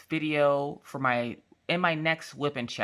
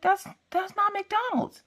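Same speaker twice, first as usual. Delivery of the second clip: put-on very high, slow kid whine; whining child. Daughter objecting to fake McDonald's, high drawn-out whine. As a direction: imitate a distressed child protesting. Daughter's upset protest, voiced.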